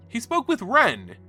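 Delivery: plain reading voice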